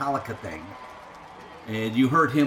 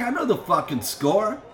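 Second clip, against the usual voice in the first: higher-pitched retold comeback; angry retort; 6 semitones higher than usual. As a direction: fire back at a heckler, mildly heated.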